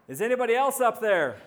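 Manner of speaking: loud, high shout with a laugh in it; yelling up. Acted. Man rejects God's advice and yells up for someone else, loud and laughing. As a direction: holler the punchline up the cliff. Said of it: "he looks back up and yells".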